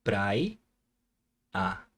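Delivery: "Prai, a" with a long pause between syllables. Shown as a demonstration. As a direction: keep "Prai" as one unit, then "a" alone. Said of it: "vamos separar".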